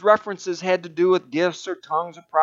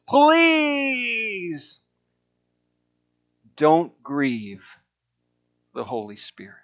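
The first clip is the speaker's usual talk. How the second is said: stretched-out plea; 2 semitones higher, much slower than usual. Retold or imitated. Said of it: "She seemed to stretch out the word".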